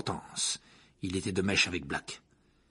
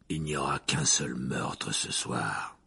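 low, slow, quiet menace; a grim threat, much lower and slower; 10 semitones lower, 4 dB quieter, slower than usual. Acted. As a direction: drop the voice and take your time.

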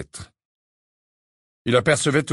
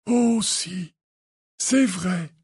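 utterly calm; 9 semitones higher, much slower than usual. A narrator's reading. Dumbledore insisting after Harry's scream, utterly calm. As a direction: insist gently, completely unruffled. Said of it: "reprit Dumbledore plus calme que jamais".

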